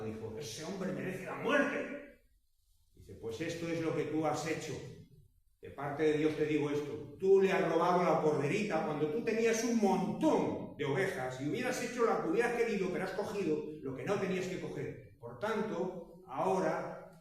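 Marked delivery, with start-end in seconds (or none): 0.4-1.8 angry verdict. Retold or imitated. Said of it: "completamente enojado". 3.1-17.2 louder, higher stern denunciation; prophet's rebuke. Retold or imitated. Prophet Nathan confronting king David, loud stern rebuke.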